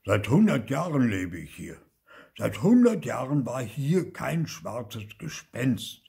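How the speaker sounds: emphatic, stern uncle; stern Onkel Oskar. A narrator's reading. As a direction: lay down the law weightily. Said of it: "Onkel Oskar sah streng aus".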